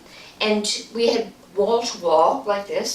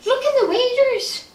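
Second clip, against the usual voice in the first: much higher, urgent and tense; spooked son; 5 dB louder than usual. Retold or imitated. Ted showing his mother the waders, high spooked urgency. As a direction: point it out in alarm.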